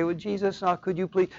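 soft, offhand mock request; casual asking. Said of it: "casually say".

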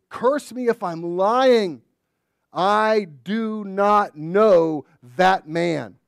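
slow, loud, weighty oath; solemn oath. Acted. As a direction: swear it slowly, word by word. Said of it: "In the holiest way".

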